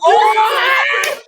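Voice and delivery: high loud cry of shock, then laughter; shocked shout. Real, not acted. Reacting to a friend's story, high loud shout. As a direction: burst out in shock.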